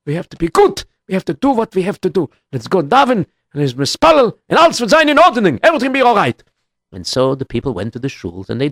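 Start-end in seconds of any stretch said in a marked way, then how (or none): 0.0-6.4 rousing encouragement. Acted. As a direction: lift their spirits with urgency. Rallying the people to pray.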